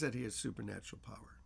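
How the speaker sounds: hushed, breathy wonder at his power; awed whisper. Real, not acted. Preacher whispers in awe of supernatural power. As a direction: whisper it like a secret too big to say aloud.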